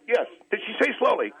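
high, loud interrogation; scolding yell. Real, not acted. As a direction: badger him at full voice. Scolding the host, demanding compliance.

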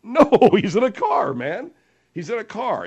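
loud, high-pitched "No"; exasperated. Real, not acted. Host setting a caller straight about the car, exasperated. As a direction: correct him in loud exasperation.

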